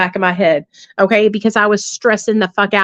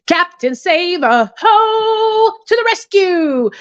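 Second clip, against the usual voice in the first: mock superhero call; 10 semitones higher, much slower than usual. Mocking her own rescuing habit.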